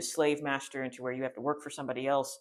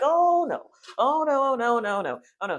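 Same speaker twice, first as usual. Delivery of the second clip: much higher, louder mock panic; panicking; 11 semitones higher, 9 dB louder than usual. The people panic at the giants, hammed-up wails. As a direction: wail like a frightened crowd. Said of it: "everybody starts panicking".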